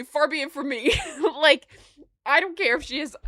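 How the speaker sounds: usual speaking voice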